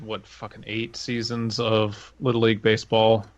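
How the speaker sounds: usual speaking voice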